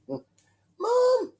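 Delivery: put-on very high, loud child's call for mum; piercing little shout for a parent's attention. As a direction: imitate a small child yelling from bed. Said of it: "when I walk up and go for a wee".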